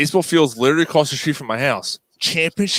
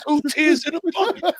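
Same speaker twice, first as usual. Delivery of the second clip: mock high reply; 13 semitones higher than usual. Sung-out joke reply.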